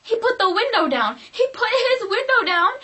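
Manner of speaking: high, loud disbelief; incredulous exclaiming. Real, not acted. Telling viewers what he did with the window, incredulous.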